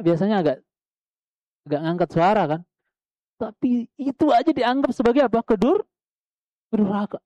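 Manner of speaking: ordinary talk, nothing marked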